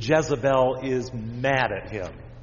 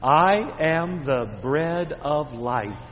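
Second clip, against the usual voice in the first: put-on slow, each word spaced out for kids to echo; slow recitation; much slower than usual. Shown as a demonstration. Pastor leading children in repeating the verse, slow and clear. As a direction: lead the children word by word, slowly. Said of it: "Let's say that with me".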